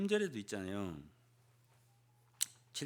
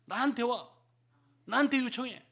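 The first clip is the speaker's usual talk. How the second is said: higher, louder inviting call; higher and louder, an eager summons; the Lord calling; 8 semitones higher, 5 dB louder than usual. Acted. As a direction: call out warmly to come. Risen Lord calling believers.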